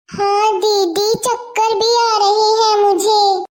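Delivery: slow, faint sick voice; feverish and weak. Acted. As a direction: drag the words out, dizzy with fever. Seema feverish and dizzy. (बुखार में धीमी कमज़ोर आवाज़)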